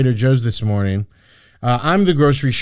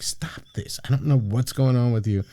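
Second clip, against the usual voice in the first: whispered public scolding, acted out; imitated discreet telling-off, starting in a whisper; hushed rebuke; 6 dB quieter than usual. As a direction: hiss it under your breath, retold.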